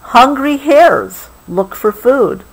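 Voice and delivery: gentle, unhurried storybook read; reading to a child. Acted. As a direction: soothe a child toward sleep with the story. Reading the book slowly.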